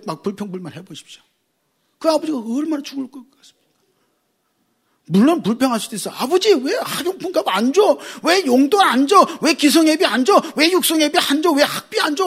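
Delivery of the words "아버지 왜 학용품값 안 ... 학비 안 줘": high-pitched nagging child, mock whine; whiny complaint. Child whining at father for money. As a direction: pester him like a greedy kid. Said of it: "막 불평해".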